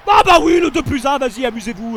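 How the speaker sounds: loud high outburst; angry shout. Real, not acted. Gamer exploding after conceding an and-one, angry shout. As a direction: yell it out in exasperation.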